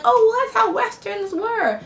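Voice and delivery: high-pitched mimicry of apologists; put-on dismissive mock voice; mocking excuse. Mimics people excusing the film.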